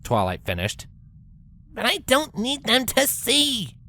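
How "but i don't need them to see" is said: high, slow brash pegasus voice; a raised tomboy voice, protesting; Rainbow protesting. A narrator's reading. Rainbow protests to Twilight about her glasses, high and slow. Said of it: "Rainbow protested".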